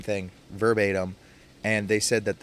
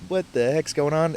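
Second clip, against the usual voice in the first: surprised; 7 semitones higher, 3 dB louder than usual. Retold or imitated. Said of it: "Trent Crimm's like".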